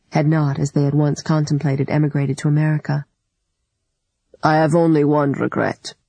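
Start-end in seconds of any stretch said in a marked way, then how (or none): 4.4-5.9 put-on lightly gravelly older man; a touch of gravel in his voice. A narrator's reading. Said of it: "he had said in his gravelly voice".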